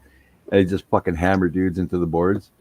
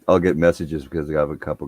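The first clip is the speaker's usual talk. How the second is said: normal talking voice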